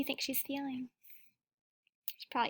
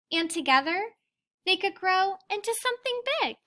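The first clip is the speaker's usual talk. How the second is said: put-on reading a picture book aloud to children; gentle read-aloud storytelling; teacher reading to the class; story reading; 3 semitones higher, 11 dB louder than usual. A narrator's reading.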